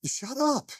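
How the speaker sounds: high inner voice telling himself off; scolding inner voice. Retold or imitated. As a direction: snap at yourself in a high pitched voice. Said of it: "and thinking".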